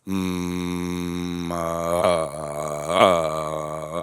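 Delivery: deep low hum with grunts, bouncing on heels; humming exercise demo. Shown as a demonstration. Coach showing viewers a grounding hum, deep and low. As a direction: hum low and let it drop into short grunts. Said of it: "you're basically humming like this".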